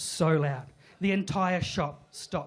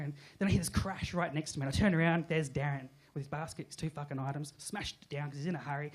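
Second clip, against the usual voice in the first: ordinary talk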